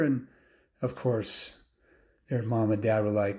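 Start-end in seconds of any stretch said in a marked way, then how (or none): none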